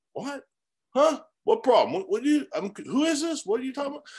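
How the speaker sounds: high, flustered stammering questions; acted confused, startled homeowner; mock bewilderment. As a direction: sound thrown by a baffling phone call. Acts bewildered seller taking odd call.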